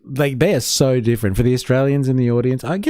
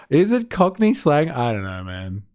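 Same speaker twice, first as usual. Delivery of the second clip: ordinary talk, nothing marked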